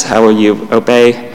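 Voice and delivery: usual speaking voice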